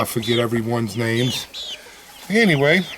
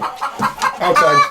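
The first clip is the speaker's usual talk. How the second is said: tiny high pet voice; high squeaky sing-song call to the chickens; 4 dB louder than usual. Real, not acted. Shooing the chickens outside.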